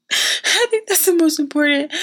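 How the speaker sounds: high, amused put-on repeat; high silly voice. Real, not acted. Speaker joking that food words matter most, high playful voice. Laughing repeat of her own remark.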